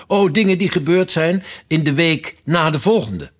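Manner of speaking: airy, high-pitched breezy answer; carefree. A narrator's reading. The queen answers Alice's question, carefree. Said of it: "antwoordde de koningin op zorgeloze toon".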